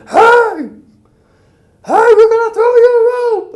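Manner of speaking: very high, loud put-on shout over the rotors; shouting from helicopter. Helicopter crew shouting down to the man on the roof, very high and loud. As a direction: holler down to the roof.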